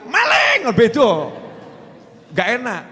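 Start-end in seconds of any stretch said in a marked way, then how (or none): 0.1-0.7 high laughing shout of the word Maling; mock thief-catcher yell. Preacher mocks a shout after a thief, high and laughing. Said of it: "teriakan riakin maling".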